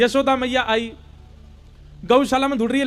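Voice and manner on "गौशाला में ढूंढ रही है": ordinary delivery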